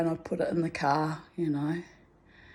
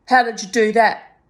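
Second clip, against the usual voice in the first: louder, higher incredulous question; loud demanding sergeant; 5 semitones higher, 10 dB louder than usual. Retold or imitated. Sergeant grilling her about the fall, loud and incredulous. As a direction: bark it at her.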